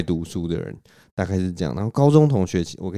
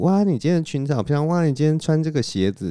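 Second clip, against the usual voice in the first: light, higher, sweet compliment voice; soft thin voice; 7 semitones higher than usual. Retold or imitated. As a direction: gush over her outfit, lightly voiced. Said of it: "那种什么细声细气的".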